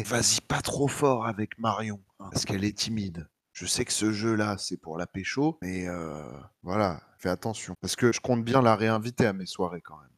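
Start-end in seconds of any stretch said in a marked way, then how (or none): 0.0-7.7 low, quiet warning aside; hushed aside. Acted. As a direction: keep it down so she won't hear. Warning friend before Marion arrives.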